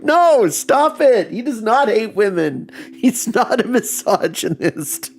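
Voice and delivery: high, slow mock protest; imitating an upset girlfriend's "No, stop it". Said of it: "And then she'll get upset".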